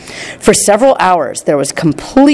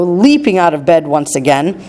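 plain reading voice

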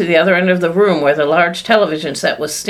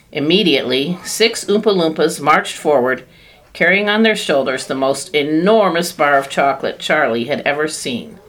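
plain reading voice

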